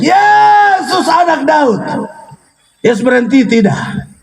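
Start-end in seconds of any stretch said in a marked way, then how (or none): 0.0-1.8 high cry easing toward the end; shouted plea. Acted. Bartimeus calling as Jesus walks on, high shout. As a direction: call out to someone walking away. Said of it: "Bartimeus teriak".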